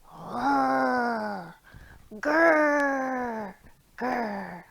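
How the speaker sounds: playful bear growls, grr grr grr; demo bear grr. Shown as a demonstration. Teacher showing children the bear sound, mock grr. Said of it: "you're gonna go".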